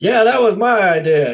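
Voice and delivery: loud, high, bragging claim; mock credit-grabbing. Crew member jokingly grabbing credit, mock boast. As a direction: crow it like it was all you. Jokes about taking the credit.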